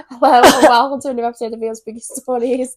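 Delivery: loud, startling show opener; podcast intro. Acted. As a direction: belt out the spooky show's welcome. Podcast intro that scares the guest.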